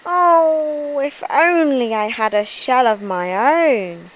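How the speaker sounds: long high "Oh", easing down longingly; wistful sigh. A narrator's reading. Norman the slug wishing for a shell, wistful. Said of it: "he sighed".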